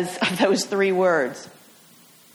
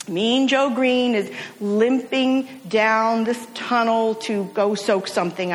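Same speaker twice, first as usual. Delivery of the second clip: ordinary talk, nothing marked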